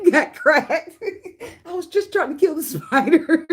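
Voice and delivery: high, giggling through the apology; fake-innocent excuse while laughing; laughing mock apology. Friend excusing the punch over a spider, high and laughing.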